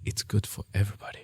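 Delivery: breathy sultry whisper; sexy voice. Shown as a demonstration. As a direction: purr it, seductive and hushed. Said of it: "Let me do it that in a sexy voice".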